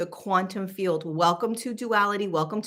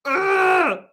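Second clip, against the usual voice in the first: angry groan; 9 semitones higher, 5 dB louder than usual. Retold or imitated. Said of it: "I was so angry".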